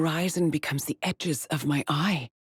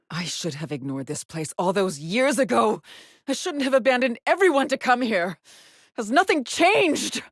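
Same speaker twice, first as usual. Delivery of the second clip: regret building from usual voice to high anguish; 6 semitones higher than usual. Acted.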